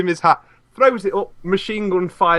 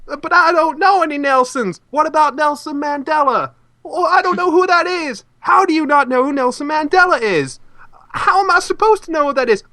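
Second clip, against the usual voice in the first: high-pitched, incredulous bickering; mock-baffled and high; exasperated argument; 8 semitones higher than usual. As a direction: act out an indignant back-and-forth in a high voice. Acts out exasperated argument from show.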